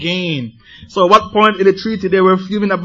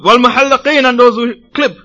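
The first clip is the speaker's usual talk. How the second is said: loud, higher shout from the crowd; screamed plea; 6 semitones higher, 6 dB louder than usual. Acted. A man in the crowd shouting his request to the Prophet, loud. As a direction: call it out over everyone. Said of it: "the man screamed out".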